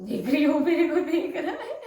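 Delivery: laughs through it, high and loud; can't stop a giggle while telling it; real embarrassed laugh. Real, not acted. Awkward stare, laughing it off.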